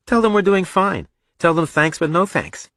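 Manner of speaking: put-on high-pitched, insistent young son; defiant boy. Son refusing his parents' school test, defiant.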